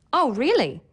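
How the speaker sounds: high, rise-then-fall surprised reaction. Shown as a demonstration. Teacher showing learners how pitch conveys meaning, surprised. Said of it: "allows you to show surprise".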